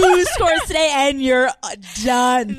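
loud, high cackling yell; joking about the girlfriend's proof, laughing yell. Acted. Yelling through laughter.

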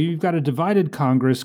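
ordinary talk

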